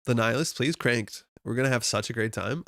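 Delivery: ordinary talk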